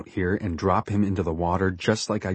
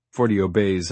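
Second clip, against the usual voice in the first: ordinary delivery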